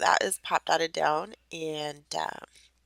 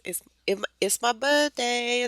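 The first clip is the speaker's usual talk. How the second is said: imitating her little daughter; 7 semitones higher than usual. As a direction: lightly voice a small child proudly announcing it. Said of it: "that's how she says birthdays".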